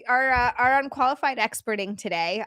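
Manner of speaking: ordinary talk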